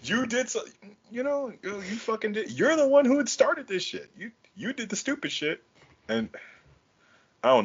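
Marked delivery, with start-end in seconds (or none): 0.0-5.6 heated rant. Retold or imitated. Ranting at partner's hypocrisy.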